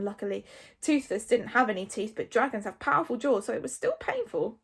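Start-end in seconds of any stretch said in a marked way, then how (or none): none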